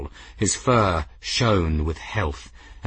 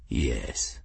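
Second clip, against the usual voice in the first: very low, quiet assent; deep, hushed cat chief; low-voiced clan chief; 10 semitones lower, 8 dB quieter than usual. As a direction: grant it softly in a deep growl. Clan chief's low voice gives consent.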